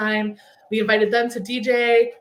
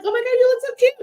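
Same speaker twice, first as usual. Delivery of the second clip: high, gushing compliment, much higher; mock gushing praise, pitched way up; excited gush; 13 semitones higher, 4 dB louder, faster than usual. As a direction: squeal it out like a delighted friend. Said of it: "they were like".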